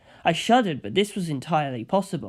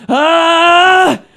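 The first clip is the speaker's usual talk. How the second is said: much higher, much louder panicked scream; terrified shriek; 12 semitones higher, 15 dB louder than usual. A narrator's reading. Kira buried under ghostly heads, shrieking in terror. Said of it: "the screams coming from inside".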